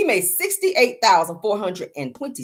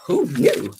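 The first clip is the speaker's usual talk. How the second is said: awed; 12 semitones higher, 5 dB quieter than usual. Retold or imitated. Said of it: "I said".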